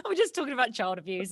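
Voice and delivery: loud burst of laughter then laughing words; can't stop giggling at the dark joke. Real, not acted. Host cracking up at a guest's story, laughing through the words. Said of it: "You're making me laugh".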